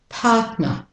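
non-rhotic British Australian "partner"; British Australian pronunciation. Shown as a demonstration. Teacher gives partner first, British Australian accent. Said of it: "the British Australian first".

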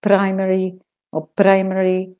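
"primary" with a flicked, tapped r. Shown as a demonstration. Teacher shows learners what to avoid, flicked R's in "primary". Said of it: "So no flicking R's".

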